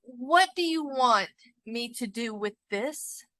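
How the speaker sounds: ordinary delivery, nothing marked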